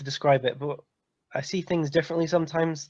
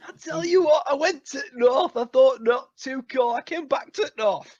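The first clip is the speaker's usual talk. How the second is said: higher laughing Yorkshire grumble; mock Yorkshire accent; 7 semitones higher than usual. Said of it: "different Yorkshire accents".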